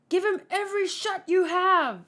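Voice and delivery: high, loud battle command; acted commander's shout; shouted order. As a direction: order all-out fire at full voice. Officer orders all-out fire.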